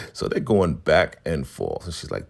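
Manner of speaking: normal talking voice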